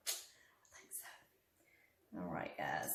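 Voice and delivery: unvoiced whisper. Real, not acted. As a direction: drop to a whisper. Whispered aside.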